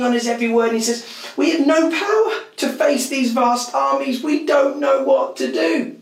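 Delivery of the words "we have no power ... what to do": a king's helpless cry, pitched high and loud; desperate king. A narrator's reading. King before his gathered nation, crying out helplessly.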